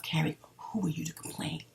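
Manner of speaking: whispered self-talk; whispering. Retold or imitated. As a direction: hush it to yourself. Quoting her own thoughts to herself.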